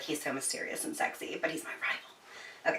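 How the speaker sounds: whispered, breathless crush talk; swooning whisper. Retold or imitated. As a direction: whisper it like a dreamy girl. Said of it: "she was like".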